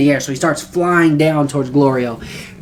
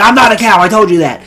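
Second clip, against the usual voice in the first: indignant retort, higher and louder at first; mock-angry outburst, pitched up; mad; 5 semitones higher, 5 dB louder, faster than usual. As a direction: protest an insult, acting mad. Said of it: "he's getting really mad".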